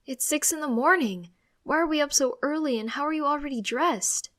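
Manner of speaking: put-on sleepy teen protesting to mom; exasperated protest. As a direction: complain loudly about the hour. Teen protests early wake-up.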